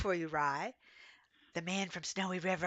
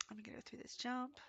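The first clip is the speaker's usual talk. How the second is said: whisper; 5 semitones higher, 14 dB quieter than usual. Real, not acted. Focused on riding a jump.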